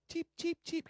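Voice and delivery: high little chirps; chirping cricket voice. Shown as a demonstration. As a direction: peep like a bird. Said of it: "Can you go like this?".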